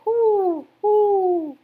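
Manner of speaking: high, loud owl hoot. Acted. Owl bidding the rabbit goodbye, hooting loudly. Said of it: "said the owl".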